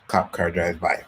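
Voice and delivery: normal talking voice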